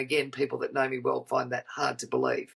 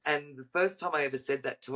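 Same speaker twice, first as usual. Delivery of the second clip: usual speaking voice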